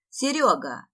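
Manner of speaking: loud, acted shout of a name; brief hearty holler; calling out. A narrator's reading. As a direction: call out to a friend across the room. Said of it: "раздался крик".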